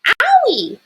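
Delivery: high loud little yelp of pain. A narrator's reading. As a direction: yelp like a burnt child. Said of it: "yelled Baby Bear".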